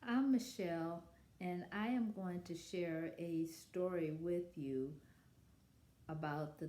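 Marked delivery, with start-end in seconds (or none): none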